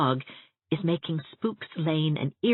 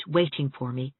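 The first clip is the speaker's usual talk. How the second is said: plain reading voice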